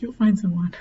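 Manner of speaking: imitating Ray's comfort. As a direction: play Ray cheerfully reassuring her. Ray consoles the stranger.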